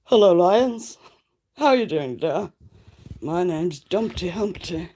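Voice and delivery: loud, bright greeting in a silly egg voice; Humpty Dumpty voice. Pretend. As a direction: greet the chat as a cheery talking egg. Voicing Humpty Dumpty for chat.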